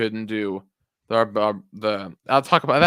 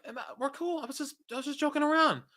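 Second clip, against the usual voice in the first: higher, fast mock innocence; high mock innocent; 5 dB quieter, faster than usual. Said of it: "I was like".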